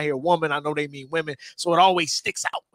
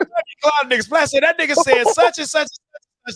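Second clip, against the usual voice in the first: excited retelling; 10 semitones higher, 6 dB louder than usual. Real, not acted.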